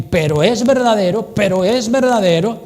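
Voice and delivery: "verdadero" loud and rising; stress on verdadero. Shown as a demonstration. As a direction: lift "verdadero" proudly. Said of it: "Cuando tú pones el énfasis en". (énfasis alto en "verdadero")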